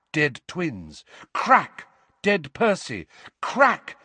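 ordinary delivery

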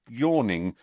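usual speaking voice

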